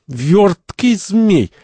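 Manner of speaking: high, very slow strained words; puffing with effort. Acted. Second wrestles the slippery cardinal, puffing. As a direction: drag it out as if straining. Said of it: "Второй пыхтит".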